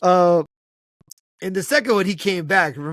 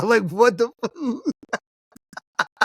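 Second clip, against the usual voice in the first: higher, slow, breaking into real laughter; cracking up; 7 semitones higher, much slower than usual. Real, not acted. Friend retelling the Chuck Norris snake joke, cracking up. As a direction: lose the words in laughter.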